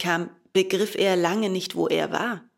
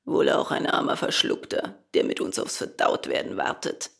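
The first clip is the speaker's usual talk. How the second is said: low, husky, gloomy dolphin; deep hoarse creature; 8 semitones lower than usual. A narrator's reading. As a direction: croak it low and resigned. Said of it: "eine heisere Summe".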